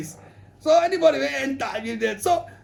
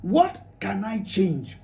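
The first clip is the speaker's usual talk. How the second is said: usual speaking voice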